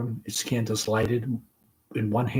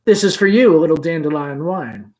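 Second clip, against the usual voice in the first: louder showy offering; extravagant gallantry; 4 semitones higher, 8 dB louder than usual. Acted. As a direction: present it grandly. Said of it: "with an extravagant gallantry".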